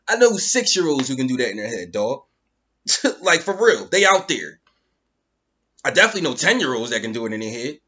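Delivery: ordinary talk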